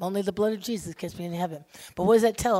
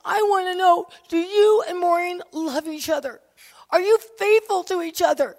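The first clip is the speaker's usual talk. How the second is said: high, loud, desperate plea; retold anguished outburst; imitating sobbing friend; 9 semitones higher, 7 dB louder than usual. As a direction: demand it urgently, much higher and louder. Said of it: "she was just sobbing".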